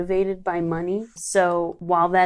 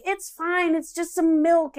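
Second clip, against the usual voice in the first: high, breezy, offhand husband; lightly mocking a carefree husband; lackadaisical; 12 semitones higher, slower than usual. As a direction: shrug it off like it's nothing.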